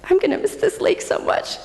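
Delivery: high, thin mock-sorrowful voice; mock lament. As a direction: squeak it out like an exaggerated lament. Retold lament to God over lake.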